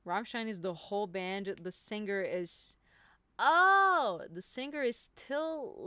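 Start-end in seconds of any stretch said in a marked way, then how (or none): 3.4-5.7 loud, high-pitched "oh" of discovery; a big, swooping oh of surprise; sudden realization. Real, not acted. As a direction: burst out as it suddenly dawns on you. Sudden realization about the singer.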